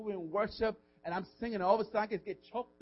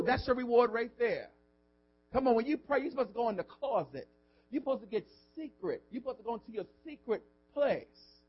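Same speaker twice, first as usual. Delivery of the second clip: ordinary delivery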